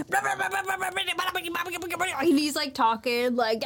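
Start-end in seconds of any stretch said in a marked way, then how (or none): 0.1-2.1 high, rapid gibberish chatter; mock villain babble. Villain rambling, imitated.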